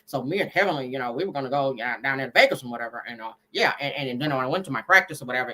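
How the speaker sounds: low, mumbled through barely open lips; lazy low mimic of a man hiding his teeth; mocking Damon talking. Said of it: "he talk like that".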